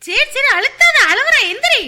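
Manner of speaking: high, loud scolding cry. Acted. Mother scolding child for pressing the baby, high and loud.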